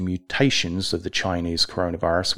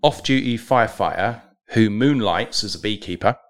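normal talking voice

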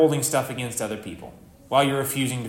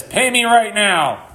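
loud, high, drawn-out mock demand; hammed-up slow, forceful order; angry demand; 7 semitones higher, 6 dB louder, slower than usual. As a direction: imitate a creditor hounding a debtor. Servant demanding debt in retold parable.

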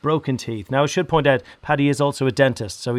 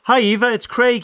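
bright greeting; 9 semitones higher, 3 dB louder than usual. Real, not acted. Greeting caller on phone.

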